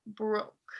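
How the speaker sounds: normal talking voice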